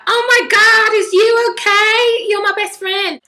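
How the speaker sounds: put-on high, loud, squealing girly gush; mocking gushing fresher girl. Student mocks a clingy fresher girl in a high squealing voice. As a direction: parody an over-the-top new best friend. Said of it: "and you're just like".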